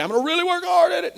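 high, rushed burst of determination; mock straining effort. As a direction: parody someone trying way too hard. Mock resolve breaks into strained effort.

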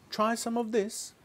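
very high little voice; coaxing. A narrator's reading. George offering medicine to the brown hen, high coaxing.

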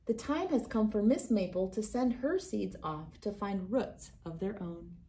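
plain reading voice

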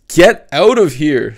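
loud, slow furious shriek. Acted. Sophie screaming at Cap to get out, loud shriek. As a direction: scream it at the intruder. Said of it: "Sophie shrieked".

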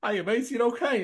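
cheery greeting. Retold or imitated. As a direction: greet him with friendly surprise. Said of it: "And he just said".

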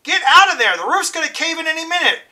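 high frantic yell across the fire; screaming. Acted. Dally screaming at Ponyboy to leave the burning church, high and loud. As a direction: yell at him to get out, voice climbing.

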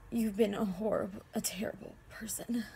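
dropping to near whisper; quiet, pained judgement; hushed reproach. Acted. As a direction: breathe out the accusation softly. Acted bitter love confession.

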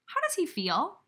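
ordinary talk, nothing marked

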